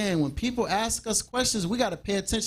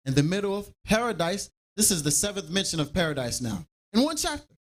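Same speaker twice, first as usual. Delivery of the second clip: normal talking voice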